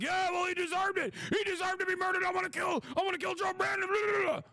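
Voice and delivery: high mocking yell, hammed-up; over-the-top parody of a ranting Republican, shouting high. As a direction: shriek it like a cartoon of a bloodthirsty fan. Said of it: "He'd be like".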